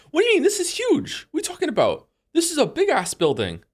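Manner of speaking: high-pitched, incredulous protest; worked-up squeaky outburst at the chat; indignant disbelief. Real, not acted. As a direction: protest loudly and high, as if scandalized. Disputes chat calling Colosseum small.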